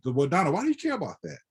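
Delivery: high-pitched, quick friendly challenge; acted-out baffled pushback to a friend; retold incredulous question. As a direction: ask in high, puzzled disbelief.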